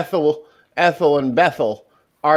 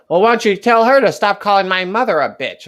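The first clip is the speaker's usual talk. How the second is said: raised, higher-pitched indignant complaint; mock-outraged demand; arguing old person; 6 semitones higher, 4 dB louder than usual. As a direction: imitate a quarrelsome senior. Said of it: "They were arguing over".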